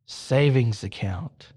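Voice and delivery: low, slow, deliberate command; insistent voice from above; voice of God. Acted. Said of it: "or the voice goes".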